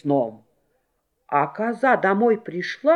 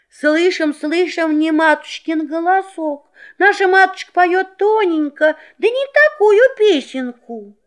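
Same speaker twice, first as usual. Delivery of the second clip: put-on kid goats' voices; 10 semitones higher than usual. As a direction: answer like little goats. Kids refuse the wolf.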